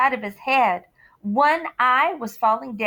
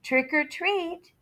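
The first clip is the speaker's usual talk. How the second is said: slow, higher trick or treat; louder trick or treat; a little louder; 7 semitones higher, slower than usual. Acted. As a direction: nudge it a bit louder. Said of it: "so he said it a little louder".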